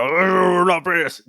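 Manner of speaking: high, put-on offended voice; mock Irish indignation. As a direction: act huffy Irish pride. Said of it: "and we go".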